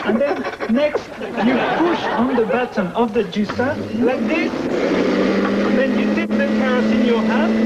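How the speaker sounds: put-on French-accented English, quickening toward the end; a French chef demonstrating the juicer in a French accent. Chef shows children the juicer, French accent. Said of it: "I love that French accent".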